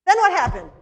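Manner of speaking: lightly voiced curious little boy; child voice. Child asking eagerly in story.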